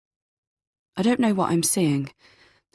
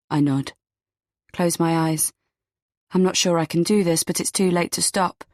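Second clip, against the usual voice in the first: ordinary delivery, nothing marked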